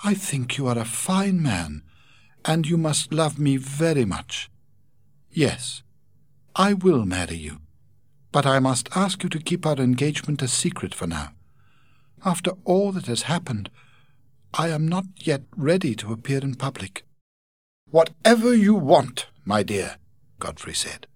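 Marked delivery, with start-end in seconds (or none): 0.0-17.0 put-on higher, gentle young woman's voice; hesitant Rachel accepting. Rachel accepts but asks secrecy. 17.9-20.0 higher, louder eager agreement; doting Godfrey. Acted.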